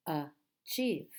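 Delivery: "achieve" split as a, chieve with a gap; syllable by syllable. Shown as a demonstration. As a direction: break "achieve" into two separate syllables. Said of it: "How many syllables in this word?".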